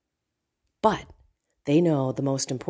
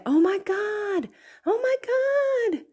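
much higher, slow, drawn-out shocked exclamation; mock careless gasp; slower than usual. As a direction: stretch it out like a dramatic overreaction. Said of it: "in reaction to anything".

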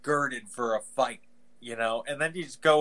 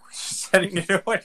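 fast, higher, louder laughing quote; laughing Amazon rep; 7 semitones higher, 7 dB louder, faster than usual. Retold or imitated. Host voicing the Amazon rep through laughter, fast and louder. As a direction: rattle it off laughing.

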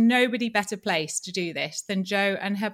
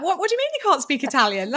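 mildly incredulous, higher and quick; surprised friends; 6 semitones higher, 4 dB louder, faster than usual. Retold or imitated. Friends astonished she can't speak Italian, mock surprise. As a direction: imitate friends' disbelief in a quick, raised voice. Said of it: "it surprises all my friends".

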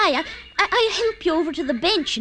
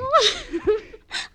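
tearful laugh; 8 semitones higher than usual. Acted. Laughs while he trails off.

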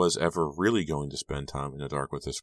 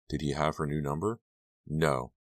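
usual speaking voice

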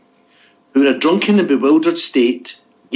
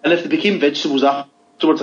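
ordinary delivery

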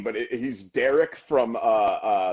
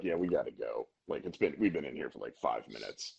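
much lower and softer, fast grumble; impatient old dad; 10 semitones lower, 10 dB quieter, faster than usual. Retold or imitated. Retelling the impatient father rushing his son out, low and soft. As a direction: mutter like a dad hurrying his kid out. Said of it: "And his dad was like".

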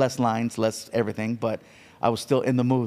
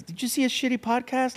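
mocking gossip; 11 semitones higher than usual. Imitating mocking critics.

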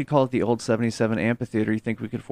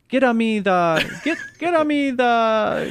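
slow, high mock Italian kid voice; Italian accent; 10 semitones higher, slower than usual. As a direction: ham up an Italian child accent. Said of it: "They also sometimes sound Italian".

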